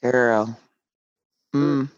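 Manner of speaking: ordinary talk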